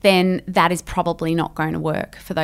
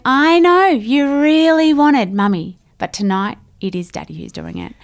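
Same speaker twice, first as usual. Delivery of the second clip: firm calm reassurance; 6 semitones higher, 4 dB louder than usual. Shown as a demonstration. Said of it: "you're going".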